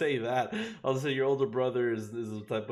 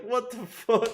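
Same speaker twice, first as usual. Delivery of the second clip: high, louder outraged viewer, laughing through it; mock stunned viewer; 10 semitones higher, 5 dB louder, slower than usual. Muted viewer reacting, high mock outrage while laughing. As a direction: play the muted guy's disbelief with a laugh.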